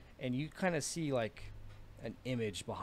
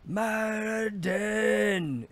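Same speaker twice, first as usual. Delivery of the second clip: long drawn-out battle scream, high and loud; screaming Maradin; 11 semitones higher, 10 dB louder, much slower than usual. A narrator's reading. Dwarf warrior screaming his god's name mid-leap. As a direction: roar the god's name as he leaps. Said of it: "And he screams".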